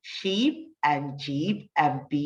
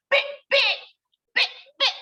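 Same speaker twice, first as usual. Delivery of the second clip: high, sharp beep beep of a jeep horn; faster than usual. Acted. Sheep honking the jeep horn at the duck, high beeps. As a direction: honk like a car horn.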